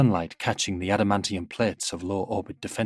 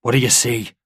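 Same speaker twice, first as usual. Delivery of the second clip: louder, pressing question; demanding; 3 semitones higher, 6 dB louder than usual. A narrator's reading. Father questions his daughter, demanding and louder. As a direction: demand an answer from the child. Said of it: "her father demands".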